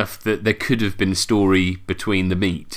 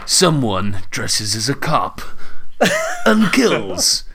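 slow, dramatic trailer voiceover; movie trailer voice; 4 semitones higher, slower than usual. Pretend. Pitching film as trailer.

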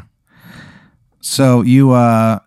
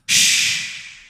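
whispered shh; shushing; 7 dB quieter than usual. Acted. Henchmen popping up to whisper shh. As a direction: hush them with a whisper.

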